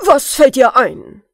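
loud, high demand; impatient shout. Acted. The king impatiently demands an idea from the dwarf. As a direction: snap the question out. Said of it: "rief der König ungeduldig".

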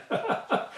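quiet, breathy laugh at own joke; real little laugh, mostly breath; short chuckle. Real, not acted. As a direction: chuckle softly after the punchline.

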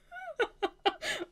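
high squealing giggle; delighted laugh. Real, not acted. Reader thrilled by the novel, high giggle.

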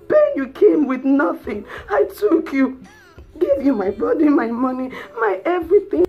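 loud, high, wounded outburst; betrayed wife shouting in outrage; furious tirade. Acted. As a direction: hurl each sacrifice at the husband. Betrayed wife rages at husband.

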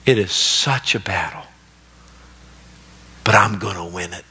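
quiet, lightly voiced earnest wife; wife's resolve. Wife promising her pastor she will win, quiet and earnest. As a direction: say it softly and sincerely. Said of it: "listen what she said".

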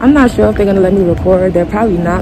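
muffled by cloth mask; speaking behind mask; through a face mask. Real, not acted.

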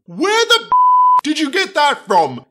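very high, loud outburst of alarm; panicked high-pitched demand; shocked exclamation. A narrator's reading. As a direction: exclaim in aghast disbelief. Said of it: "Gordon exclaimed".

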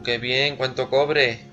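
ordinary delivery

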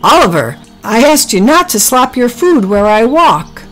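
mild story-time yell, pitch climbing; angry yell. A narrator's reading. Henry tells off Oliver after slipping, yelling crossly. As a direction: scold with a half-yell. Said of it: "He yelled".

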